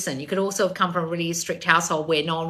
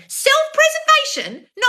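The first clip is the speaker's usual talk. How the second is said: high, slow disbelieving repeat then scolding; mock the word in disbelief; sarcastic outrage; incredulous scoff; 6 dB louder, slower than usual. Real, not acted. Scoffing at excuse.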